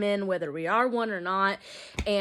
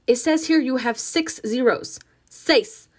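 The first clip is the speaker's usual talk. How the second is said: put-on louder, accusing Spanish-accented mother; scolding mother; 4 semitones higher, 6 dB louder than usual. Ma confronting her daughter over zeros, louder. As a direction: count the zeros at her in disgust.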